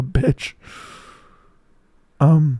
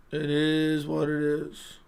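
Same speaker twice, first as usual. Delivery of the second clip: slow, softer, stretched-out words; drawn-out repeat; 6 dB quieter, much slower than usual. Real, not acted. Streamer resigned to his hurting throat, dragging the words out slowly. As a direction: drag out IS, letting it sink.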